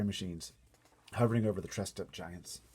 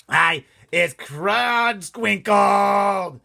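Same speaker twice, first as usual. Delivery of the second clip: much higher, much louder, very slow yowling giant; giant's yowl; 12 semitones higher, 17 dB louder, much slower than usual. Acted. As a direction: draw out a long yowl like a wounded giant. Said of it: "yowled the blood bottler".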